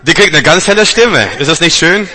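elated shout; ecstatic delight. Real, not acted. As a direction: rejoice over the new voice. Delighted at healed voice.

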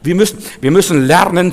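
ordinary delivery

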